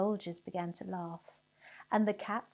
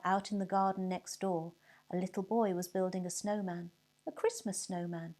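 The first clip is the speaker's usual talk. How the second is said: ordinary delivery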